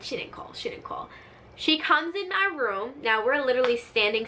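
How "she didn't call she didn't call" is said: very soft, voiceless whisper; hushed, repeated whisper; whispered denial. Acted. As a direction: whisper it as the brother in disbelief. Brother's secret reaction, retold.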